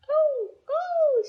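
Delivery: high, loud "oh"s; delighted cry. A narrator's reading. Goldilocks cries out at finding the baby bed, high and delighted. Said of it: "she cried".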